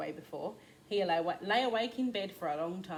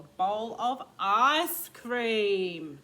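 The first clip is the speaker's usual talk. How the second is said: excited, slow and loud; 3 semitones higher, 9 dB louder, much slower than usual. Acted. Mum describes ice cream joy.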